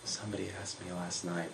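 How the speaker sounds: very soft, reluctant reply; barely muttered. Retold or imitated. Cheerleader turns down boy before class, barely muttering. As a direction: murmur the let-down almost inaudibly. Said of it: "she barely even mutters".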